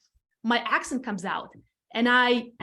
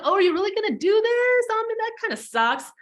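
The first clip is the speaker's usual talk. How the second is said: high-pitched, louder mock disbelief; mimicking doubtful colleagues; 11 semitones higher, 4 dB louder than usual. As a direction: imitate sceptical coworkers way up high.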